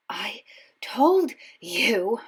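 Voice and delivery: breathless whispered gasp; breathless whisper, clutching a stitch. A narrator's reading. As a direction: gasp it out, winded from running. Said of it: "Hermione gasped".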